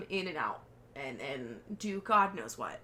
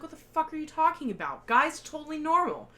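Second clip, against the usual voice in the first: higher, louder, outraged disbelief; shocked disbelief; 7 semitones higher, 7 dB louder than usual. Retold or imitated. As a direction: blurt it out in shock. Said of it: "And she's like".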